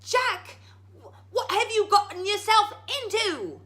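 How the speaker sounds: high, loud panicked shout; frightened yell. Acted. Mother yelling up at Jack, panicked. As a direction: shout up at your son in fright. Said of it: "she yelled up at her son".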